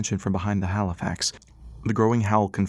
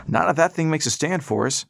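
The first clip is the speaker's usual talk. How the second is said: higher, pushing back hard; old man voice; 7 semitones higher, 3 dB louder than usual. Acted. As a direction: argue back at the soldier. Pop arguing with soldier.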